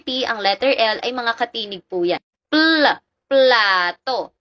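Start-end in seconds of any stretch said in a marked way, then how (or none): 2.5-4.3 plato said slowly, the pl isolated first; cluster sounded out. Shown as a demonstration. Teacher sounding out the pl cluster in plato, slow. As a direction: stretch out the pl before saying plato. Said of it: "Yung pla".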